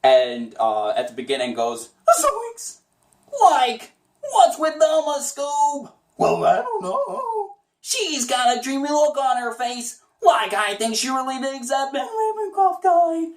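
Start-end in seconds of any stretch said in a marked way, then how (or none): 2.2-13.3 high Shaggy gossiping to Scooby; Shaggy movie scene. Shown as a demonstration. Shaggy telling Scooby about Velma's crush, high and goofy. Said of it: "how I really got the voice down".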